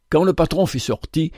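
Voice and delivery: ordinary delivery, nothing marked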